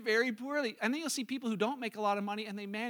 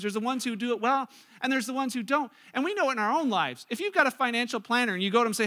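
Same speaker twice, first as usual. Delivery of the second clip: ordinary talk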